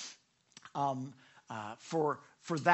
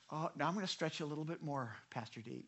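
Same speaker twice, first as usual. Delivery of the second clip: God's calm reply; 2 semitones lower, 10 dB quieter, faster than usual. Acted. Voices God answering him.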